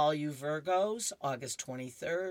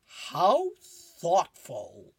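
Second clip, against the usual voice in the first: very slow, louder drawn-out thanks; Snoopy voice; 3 semitones higher, 9 dB louder, much slower than usual. Acted. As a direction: stretch out the mock gratitude. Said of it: "and he says".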